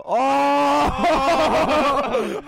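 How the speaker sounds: high, loud surprised whoop; surprised laughing shout. Real, not acted. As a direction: squeal it out laughing.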